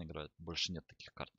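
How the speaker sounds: normal talking voice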